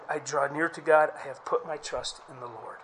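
ordinary delivery

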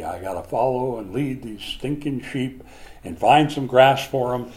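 ordinary talk

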